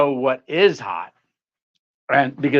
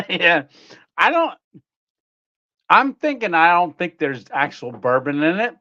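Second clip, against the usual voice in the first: normal talking voice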